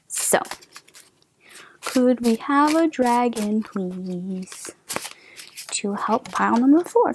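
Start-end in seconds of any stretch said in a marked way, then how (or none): none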